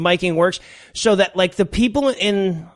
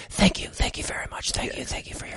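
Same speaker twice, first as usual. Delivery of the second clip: mock whispered thank-yous, very soft; talking quieter; 3 semitones lower, 9 dB quieter than usual. Mayor thanking the crowd in the background, in a whisper. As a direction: whisper it like a badly miked speaker drifting off. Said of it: "the people actually start talking quieter".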